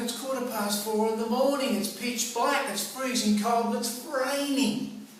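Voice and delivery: high, hint of groggy whining; lightly voiced sleepy complaint; mock whiny protest. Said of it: "I'm saying".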